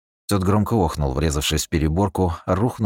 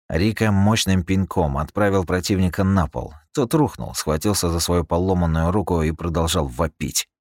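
usual speaking voice

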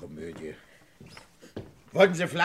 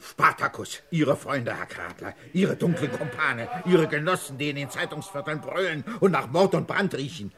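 normal talking voice

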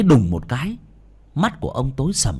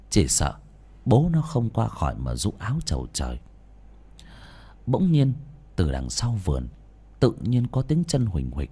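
ordinary delivery

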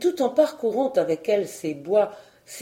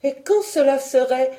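higher, louder indignant retort; annoyed; 5 semitones higher, 5 dB louder than usual. A narrator's reading. Marguerite answers her mocking cousin, annoyed. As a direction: snap back, stung. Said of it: "dit Marguerite fâchée".

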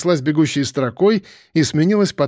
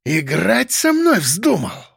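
slow, raised, menacing rasp; hoarse anger; 4 semitones higher, slower than usual. A narrator's reading. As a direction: drag the question out as a threat. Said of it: "прохрипел он".

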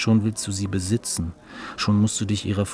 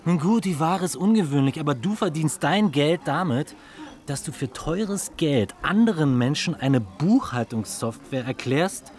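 indignant; 7 semitones higher than usual. Acted. Heated argument over the offer.

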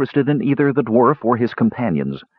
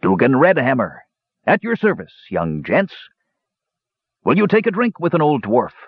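hearty dwarf voice, pitched up and friendly; a cheerful dwarf offering drinks; 6 semitones higher than usual. A narrator's reading. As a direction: greet the young men like a jolly host. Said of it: "said cheerfully".

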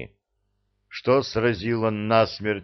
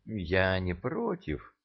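hesitant drawl; 6 dB quieter, slower than usual. Acted. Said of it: "неуверенно протянула царица".

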